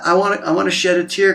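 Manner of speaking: ordinary talk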